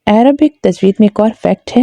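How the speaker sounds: ordinary delivery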